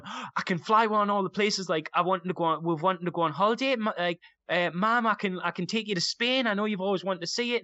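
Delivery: put-on high, eager little-boy chatter; excited kid. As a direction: bubble over with a child's excitement. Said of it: "so excited, going".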